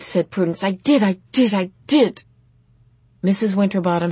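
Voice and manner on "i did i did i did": louder, hammering each repeat; mildly wailed teenage protest; distraught insistence. A narrator's reading. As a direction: insist on your own failure. Prudence insists she failed.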